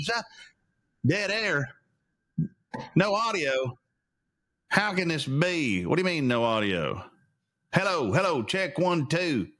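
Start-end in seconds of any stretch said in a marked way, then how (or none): none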